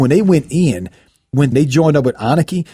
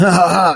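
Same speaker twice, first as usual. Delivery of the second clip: mock "ha, ha, ha" laugh, pitched up; 7 semitones higher than usual. Gang leaders mocking the lieutenant with laughter. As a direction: laugh at him scornfully. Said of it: "they start laughing".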